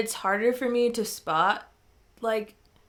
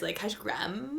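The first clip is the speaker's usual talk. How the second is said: put-on Valley Girl upspeak; 10 dB quieter, slower than usual. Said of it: "do that at the end of your words".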